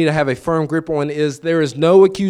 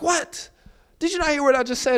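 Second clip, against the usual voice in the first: high, stunned mock disbelief; incredulous; 12 semitones higher, 5 dB quieter than usual. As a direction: act shocked nobody cared, way up high. Disbelief at friend's reaction.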